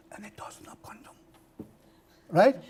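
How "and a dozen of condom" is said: whispered. Retold or imitated. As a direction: whisper it, embarrassed. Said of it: "very loudly".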